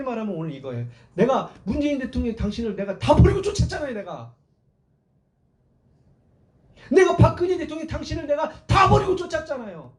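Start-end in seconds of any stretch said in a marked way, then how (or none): 1.1-9.9 acted outraged protest, rising higher; high, aggrieved outburst; indignant remonstration. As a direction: protest like a devoted supporter let down. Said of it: "따지는 거예요".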